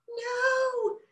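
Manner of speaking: tiny high wailing no; wailed no. A narrator's reading. The bud wailing at Bella, high wail. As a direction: wail it like an upset little flower. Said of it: "the voice wailed".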